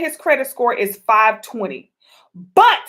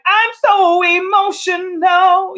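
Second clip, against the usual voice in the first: slow sung whine; a hammed-up, slow singsong; mock emotional; 6 semitones higher, 4 dB louder, much slower than usual. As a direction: sing out the drama, slowly. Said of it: "your feminine energy is like".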